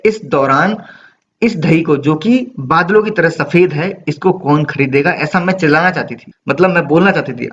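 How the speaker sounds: normal talking voice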